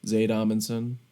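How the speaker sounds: slow radio station ident, evenly paced. Pretend. Co-host joining in on the radio voice bit, mock radio voice. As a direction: play the radio announcer, drawing out the call letters. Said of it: "I wanna develop a radio voice".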